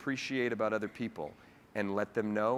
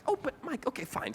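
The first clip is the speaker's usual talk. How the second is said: high, soft voice of a mom giving in; imitated motherly protest, high and soft; reluctant mom; 13 semitones higher, 4 dB quieter than usual. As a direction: grudgingly give in to your son, pitched up and quiet. Mom reluctantly gives in to son.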